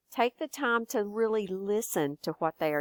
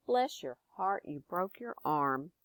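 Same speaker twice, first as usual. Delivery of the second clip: softer Texan "Bless your heart" of sympathy; 2 semitones lower, 7 dB quieter than usual. Shown as a demonstration. Consoling someone who broke their arm, soft Texan. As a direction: murmur it kindly to someone hurt. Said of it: "maybe you broke your arm".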